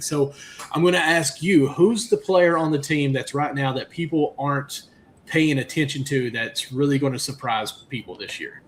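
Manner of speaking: ordinary talk, nothing marked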